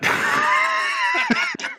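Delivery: shrieking laugh, very high; burst of high laughter. Real, not acted. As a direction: crack up helplessly. Breaks up at own voice.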